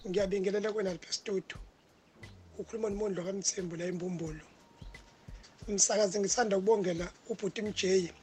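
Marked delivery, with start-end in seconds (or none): none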